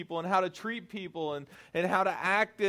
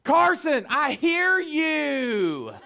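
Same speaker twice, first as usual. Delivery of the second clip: loud high holler, last words drawn out; yelling back; 9 semitones higher, 6 dB louder, much slower than usual. Retold or imitated. Son yelling back at nagging parent, exasperated drawn-out shout. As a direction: imitate a kid's exasperated yell, stretched long.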